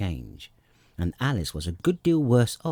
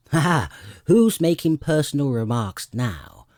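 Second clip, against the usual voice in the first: gloating, louder mock laugh; triumphant; 5 semitones higher, 6 dB louder than usual. A narrator's reading. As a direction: crow over Alice with a laugh. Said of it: "the Hatter asked triumphantly".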